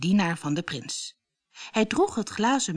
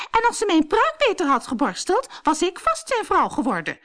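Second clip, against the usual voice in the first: high, cross snapping; 12 semitones higher, 4 dB louder than usual. A narrator's reading. Truida blaming Cinderella for her wig, snapping. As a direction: snap out the complaint loudly. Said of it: "snauwde Truida".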